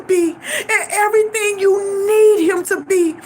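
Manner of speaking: high voice through real tears; tearful. Real, not acted. Daughter repeating mama's words about her husband, crying. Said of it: "always have the ability to make me cry".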